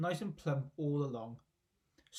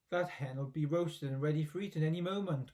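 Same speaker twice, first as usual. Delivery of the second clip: plain reading voice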